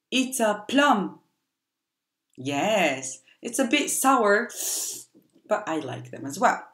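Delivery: normal talking voice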